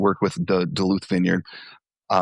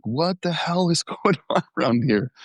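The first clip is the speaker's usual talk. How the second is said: high, incredulous laughing question; half-laughing mock bewilderment; retold disbelief; 8 semitones higher than usual. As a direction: imitate baffled onlookers, higher and half laughing. Staff's baffled reaction to the fight.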